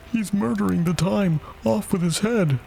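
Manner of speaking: high-pitched outcry without real volume; mock bawling. A narrator's reading. Queen condemning the Hatter, mock bawling. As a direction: bawl it high, held back in level. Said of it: "when the Queen bawled out".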